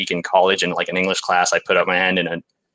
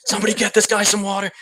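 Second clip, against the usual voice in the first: much higher, laughing mock waiter voice; 13 semitones higher, faster than usual. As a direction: squeal it out like a teasing waiter. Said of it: "And they'd be like".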